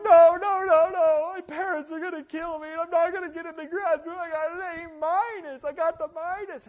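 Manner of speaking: high, very loud mock panic; student wailing about grad school, hammed-up; hysterical student. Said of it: "I'm like".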